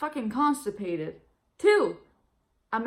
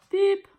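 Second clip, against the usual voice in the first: mock beep; 11 semitones higher, 9 dB louder than usual. Hanging up on doctor.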